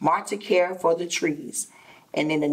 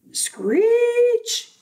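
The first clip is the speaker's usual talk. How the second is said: high loud screeching cry; 4 dB louder than usual. Acted. Storyteller imitating the monkeys' cry for listeners, high loud screech. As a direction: let out a loud animal screech.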